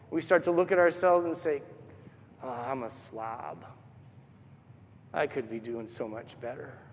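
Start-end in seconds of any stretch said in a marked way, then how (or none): 2.4-6.8 low, very soft sigh of self-reproach; dejected self-talk. Retold or imitated. Looking at ourselves on bad pandemic days, low dejected murmur. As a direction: sink down quietly, disappointed in yourself.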